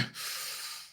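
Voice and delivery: slow shaky breath out; long nervous exhale. Acted. Franklin bracing himself at the door, long exhale. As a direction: steel yourself with a long exhale. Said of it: "I take a deep breath anyway".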